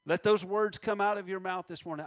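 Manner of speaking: usual speaking voice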